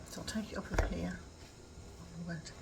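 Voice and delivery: soft unvoiced whisper. Real, not acted. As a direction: whisper it so no one wakes. Quietly removing tag, whispering.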